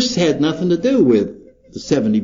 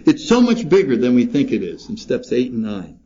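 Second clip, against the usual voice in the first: usual speaking voice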